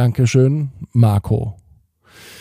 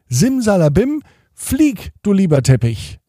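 magic spell; 8 semitones higher, 5 dB louder than usual. Acted. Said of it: "sagte er erneut".

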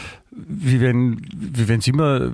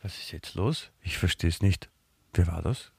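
puzzled muttering; 6 semitones lower, 10 dB quieter than usual. Acted. Pretends to be alone after hang-up.

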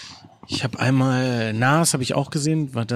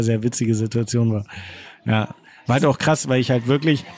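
ordinary delivery

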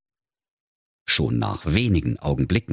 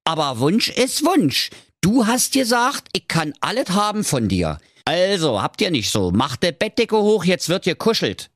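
high, insistent frog; cheeky high-pitched frog; Frankie der Frosch; 12 semitones higher than usual. Acted. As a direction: press your claim in a raised frog voice.

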